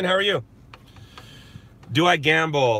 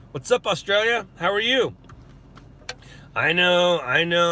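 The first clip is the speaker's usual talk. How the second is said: ordinary talk, nothing marked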